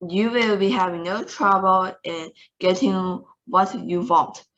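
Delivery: usual speaking voice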